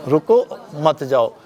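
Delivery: slow "रुको", a break, then "मत जाओ"; pause after रुको. Shown as a demonstration. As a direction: put the comma after रुको and let it be heard. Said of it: "रुको" पे comma लगा दिया".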